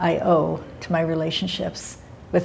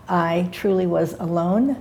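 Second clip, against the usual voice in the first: ordinary talk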